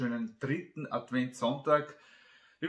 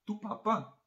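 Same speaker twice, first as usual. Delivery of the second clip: put-on higher-pitched child calling Papa; child to father; 8 semitones higher than usual. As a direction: lightly voice a child addressing Papa. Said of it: "sagt das Kind".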